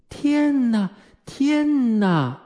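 high, drawn-out wail; high-pitched motherly exclamation; exasperated cry. A narrator's reading. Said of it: "温蒂喊道".

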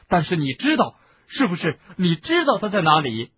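loud, high insistent questions; insistent pressing. Acted. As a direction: press him, he knows. Harry presses Kreacher.

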